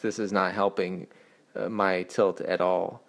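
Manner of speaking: usual speaking voice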